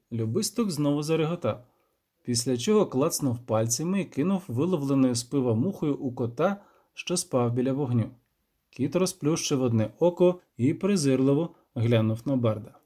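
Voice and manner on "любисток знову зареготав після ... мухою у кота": plain reading voice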